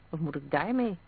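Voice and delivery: usual speaking voice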